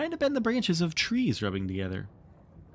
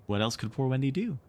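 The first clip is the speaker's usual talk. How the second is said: plain reading voice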